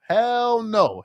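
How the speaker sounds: hell no jumps up high in pitch; high-pitched mock protest. Real, not acted. Repeated hell no, high take.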